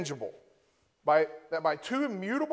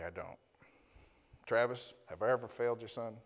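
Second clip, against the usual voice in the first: ordinary delivery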